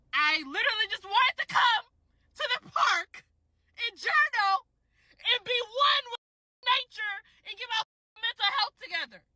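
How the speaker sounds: high-pitched, pleading complaint; exasperated lament. Real, not acted. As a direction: plead your case high, in broken phrases. Venting about being interrupted again.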